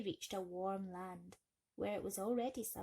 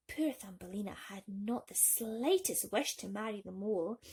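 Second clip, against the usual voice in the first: ordinary delivery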